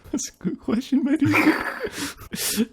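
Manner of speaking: giggled high-pitched answer; high laughing voice. Real, not acted. Mock pondering with a laugh.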